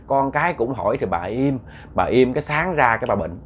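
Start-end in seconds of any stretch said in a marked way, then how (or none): none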